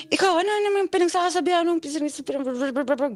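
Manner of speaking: high, stammering mock of the boyfriend; mocking his stammer. Retelling the drunk boyfriend's stammer, mocking him high. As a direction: stutter on pis- like a drunk man fumbling. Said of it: "gumanon talaga siya".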